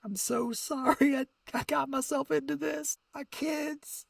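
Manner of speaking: high, soft voice breaking into tears; retold sobbing apology. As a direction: choke the apology out through quiet sobs. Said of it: "and I'm sobbing to myself".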